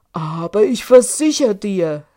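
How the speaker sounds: loud, irritated giant's shout; annoyed shout. A narrator's reading. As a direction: bellow it in annoyance. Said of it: "rief Michel unmutig".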